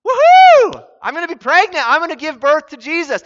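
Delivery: high, loud, whooping celebration; hammed-up jubilant squeal; mock elation. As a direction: gush like an over-the-top lottery winner. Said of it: "So Mary didn't go".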